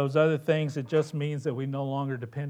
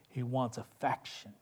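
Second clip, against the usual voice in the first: ordinary talk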